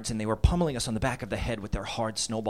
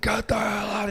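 angry dad; 7 semitones higher than usual. Retold or imitated. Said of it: "my dad, like".

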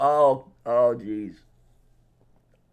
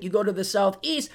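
ordinary talk, nothing marked

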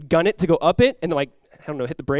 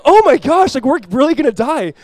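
high, loud burst of dread; hammed-up terror, starting at a peak; retold panic; 8 semitones higher, 7 dB louder than usual. Praying for families on a sinking boat, retold panic.

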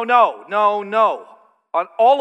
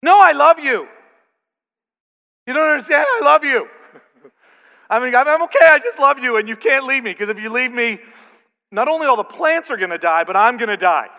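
much higher, much louder hammed-up pleading; desperate clingy boyfriend; 6 semitones higher, 10 dB louder than usual. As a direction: overplay a needy lover begging her not to leave. Mock of his clingy younger self.

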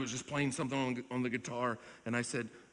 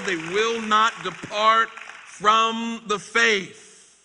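much louder, much higher and very slow, leaning on not; proclaimed line; 10 semitones higher, 12 dB louder, much slower than usual. Acted. Preacher promising the church, proclaimed loud and slow. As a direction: proclaim every word like a verdict. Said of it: "They won't depart".

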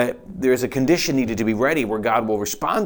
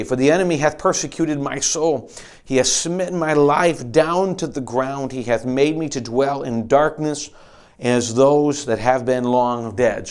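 slow, solemn, louder psalm reading; slow scripture reading; 2 semitones higher, 3 dB louder, slower than usual. Acted. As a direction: read it like a lament from the depths. Reads psalm aloud.

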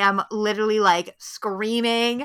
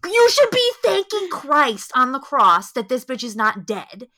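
put-on loud, slow scolding of the mean girls; loud scolding at the screen; incredulous scolding; 5 semitones higher, 7 dB louder, slower than usual. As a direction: tell them off, loud and slow. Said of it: "And I'm like".

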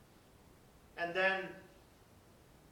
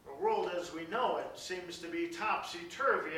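ordinary talk, nothing marked

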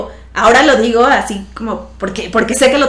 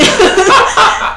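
bright high laugh bursting out; high burst of laughter; 11 semitones higher, 6 dB louder than usual. Real, not acted. Laughing at the knee joke with the surgeon: high burst of laughter. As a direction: let a real laugh break out high.

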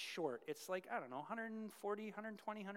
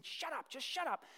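higher, fed-up mock snap; irritated snapping; 8 semitones higher than usual. Man shooing the whispering lizard, irritated snapping. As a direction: brush off a nagging voice. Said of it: "and the man's saying".

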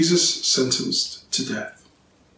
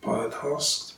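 plain reading voice

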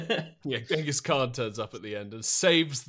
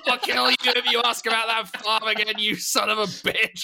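mock threat from a gun-waving old man, higher and louder, laughing through it; angry old man threat; 8 semitones higher, 6 dB louder than usual. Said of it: "pulls a fucking gun on them and says".

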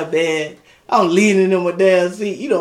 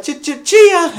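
high, loud chopped syllables; punchy mimicked catchphrase; imitated stuttering jingle; 9 semitones higher, 5 dB louder than usual. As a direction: stutter it out as a comic sound effect. Imitates how the dog had him.

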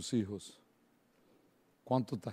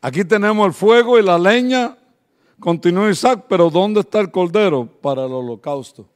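loud, higher preaching voice; 5 semitones higher, 14 dB louder than usual. Acted. Pastor to the congregation retelling Isaac's question, preaching loudly. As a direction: proclaim the question to the crowd.

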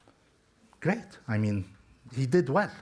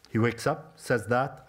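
normal talking voice

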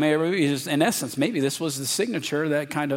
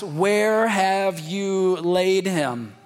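Jesus asking; 5 semitones higher, much slower than usual. Retold or imitated. Jesus asking where Lazarus lies, slow and higher. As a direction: stretch the question out, grieving.

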